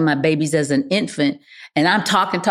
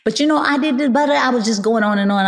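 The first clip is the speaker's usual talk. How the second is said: put-on child pleading; 6 semitones higher, faster than usual. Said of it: "I said".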